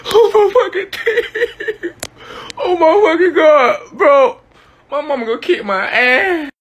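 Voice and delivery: very high wail through laughter; laugh-cry in dismay; high half sobbing, half laughing. Real, not acted. Teeth knocked out, laughing and crying.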